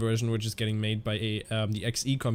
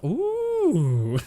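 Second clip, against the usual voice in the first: high, loud delighted ooh; impressed ooh; 13 semitones higher, 5 dB louder than usual. Real, not acted. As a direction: let out a pleased ooh. Reacting to the window view.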